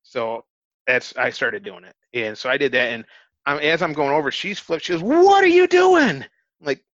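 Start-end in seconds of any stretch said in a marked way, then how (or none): 5.0-6.2 high, loud, drawn-out mock shriek; panicked shriek. Scared woman to the man flipping over, imitated shriek. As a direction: screech it like someone freaking out.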